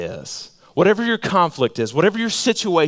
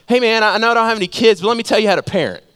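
know-it-all; 7 semitones higher, 4 dB louder, much faster than usual. Retold or imitated. Know-it-all giving unasked advice.

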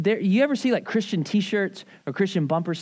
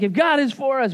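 raised, pumped-up declaration; excited rally cry; 7 semitones higher than usual. Retold or imitated. Pastor imitates his excited Sunday crowd, excited rally cry. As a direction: proclaim it like a rallying congregation. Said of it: "get you all excited about it".

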